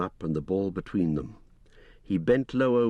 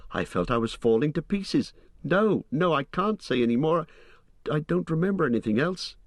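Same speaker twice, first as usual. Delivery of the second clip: put-on a higher young hobbit voice, frightened; Pippin; 6 semitones higher than usual. A narrator's reading. Frightened hobbit telling Gandalf what he saw, high and shaken.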